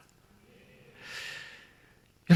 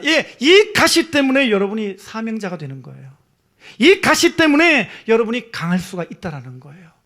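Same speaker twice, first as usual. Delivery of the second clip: forceful preaching; 3 semitones higher, 6 dB louder than usual. Acted. Preacher pressing the point on the congregation, forceful preaching. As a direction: preach it out to the whole hall.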